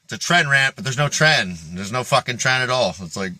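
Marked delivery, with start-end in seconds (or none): none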